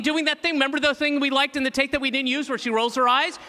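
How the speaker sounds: racing, high-pitched burst of editing ideas; acted eureka moment. Editor solving a missing shot at night, excited brainwave. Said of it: "at night, you're like".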